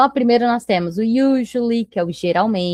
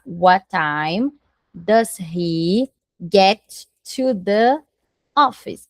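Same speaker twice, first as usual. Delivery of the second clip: slow dictation; much slower than usual. Shown as a demonstration. Teacher builds question word by word.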